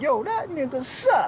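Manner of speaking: high-pitched, drawn-out mock insult; mock trash talk. Joking that jazz players never feud, mock trash talk. Mimics rappers trash-talking.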